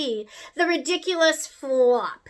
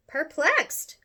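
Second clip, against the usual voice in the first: ordinary delivery, nothing marked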